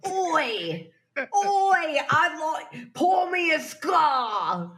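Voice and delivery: mock British accent. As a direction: bellow for a drink in a fake British accent. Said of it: "as good as your Australian accent".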